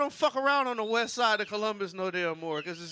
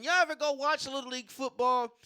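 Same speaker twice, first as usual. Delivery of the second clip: usual speaking voice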